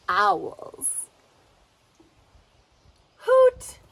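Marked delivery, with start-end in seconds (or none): 3.2-3.8 high, loud owl hoot. Shown as a demonstration. Teacher showing kids how an owl hoots. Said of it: "Let's fly like an owl".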